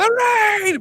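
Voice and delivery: high, loud desperate call; hammed-up panicked yell; mock frantic call. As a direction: shout her name down the floors. Husband calling after falling wife.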